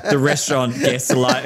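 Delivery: normal talking voice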